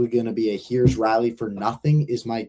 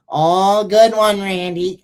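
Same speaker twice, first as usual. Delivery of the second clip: high, loud nasally sarcastic jab; a hint of a nasally sneer; 8 semitones higher, 7 dB louder, much slower than usual. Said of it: "He has that super nasally voice".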